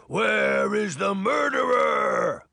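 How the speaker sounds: slow, loud, high-pitched giant roar; huge, drawn-out shouted demand; giant's bellow. A narrator's reading. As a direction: bellow it slowly across the hall. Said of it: "the giant bellowed".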